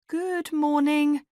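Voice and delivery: high, slow, tentative greeting; nervous. A narrator's reading. Guest greeting an angry flatmate, nervous. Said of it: "I said nervously".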